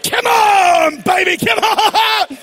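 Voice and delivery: laughing, whooping celebration at full voice; ecstatic shout. Real, not acted. Preacher shouting for joy at the Presbyterian visitors. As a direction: cheer them on like a jubilant preacher.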